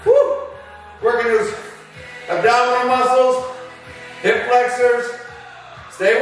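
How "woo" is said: high, loud celebratory whoop; excited whoop. Real, not acted. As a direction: whoop to pump up the class. Coach whoops mid-workout.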